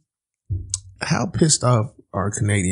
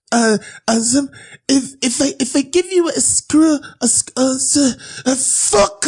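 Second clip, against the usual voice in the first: put-on high, halting French accent, climbing higher; coworker's French accent, stumbling to a curse; French guy groping for a word; 14 semitones higher than usual. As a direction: stammer for the English word like a lost Frenchman. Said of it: "He couldn't get the word right".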